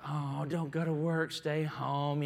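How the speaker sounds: put-on slow, pleading child begging dad to stay; upset little boy. Son begging dad not to leave for work, slow pleading child voice. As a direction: whine it like a small boy who doesn't want daddy to leave. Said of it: "is all upset and agony".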